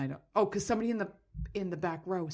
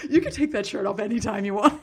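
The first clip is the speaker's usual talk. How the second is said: louder laughing catcall; 8 dB louder than usual. Pretend. Girlfriends at the pool teasing the swimmers, laughing. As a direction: egg them on playfully. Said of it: "All my girlfriends are gonna be like".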